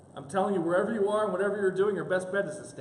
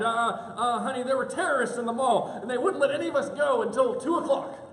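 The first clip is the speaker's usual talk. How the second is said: higher-pitched mock excuse-making; frantic excuse; 6 semitones higher, 6 dB louder than usual. Husband inventing an excuse for his wife, mock frantic. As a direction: blurt out an invented alibi.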